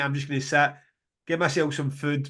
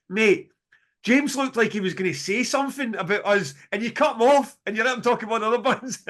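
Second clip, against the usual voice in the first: higher, lightly put-on Australian complaint; mock Australian accent; 7 semitones higher than usual. Retelling the Australian band friend's message, mock Australian accent. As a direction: play the Aussie mate teasing, lightly. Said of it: "his Australian accent".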